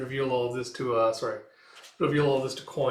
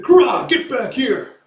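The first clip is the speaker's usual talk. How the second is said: high, loud, drawn-out roar; enraged yell; 11 semitones higher, 12 dB louder, slower than usual. Retold or imitated. Pirate to his escaping foe, enraged yell. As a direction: act out a villain yelling after escaping prey.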